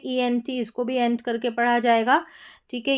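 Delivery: normal talking voice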